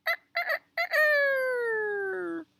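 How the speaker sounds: very high crowing, last note held; rooster crow. Shown as a demonstration. Storyteller shows children the sound, rooster crowing. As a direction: demonstrate a shrill rooster crow without words. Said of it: "Can you make that sound?".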